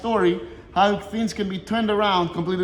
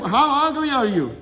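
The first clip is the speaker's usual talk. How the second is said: higher, louder, contemptuous retort; scornful rabbi; 6 semitones higher, 4 dB louder than usual. Retold or imitated. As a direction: sneer it down at him, slowing on the last words. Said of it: "The rabbi is saying to him".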